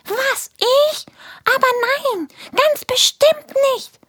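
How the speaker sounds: very high, squeaky, outraged little voice; indignant fart creature. Acted. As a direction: protest your innocence in a tiny high voice. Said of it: "antwortet Pupsi Jones entrüstet". (sehr hohe, empörte Piepsstimme)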